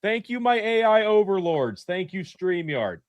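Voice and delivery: high, mock-grovelling plea to the AI; hammy high voice begging a machine; hammed-up AI request. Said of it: "So there I was".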